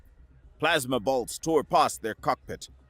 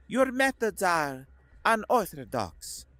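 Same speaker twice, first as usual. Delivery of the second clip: Koslov's voice; 7 semitones higher than usual. A narrator's reading. Koslov, slow.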